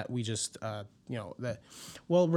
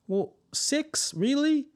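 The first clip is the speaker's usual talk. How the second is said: much higher, incredulous six and really; mock disbelief; 13 semitones higher, slower than usual. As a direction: scoff at the number six.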